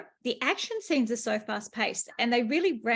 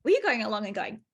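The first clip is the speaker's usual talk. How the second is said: ordinary talk, nothing marked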